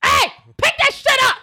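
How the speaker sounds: high-pitched, indignant holler; yelling at litterers. Retold or imitated. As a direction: call out sharply, pitch way up. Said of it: "I yell at people. I'm like".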